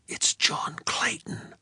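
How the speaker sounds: hushed frightened whisper. A narrator's reading. Narrator to Sadie in a dream, frightened whisper. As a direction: breathe it out, barely voiced. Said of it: "I whispered".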